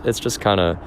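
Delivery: usual speaking voice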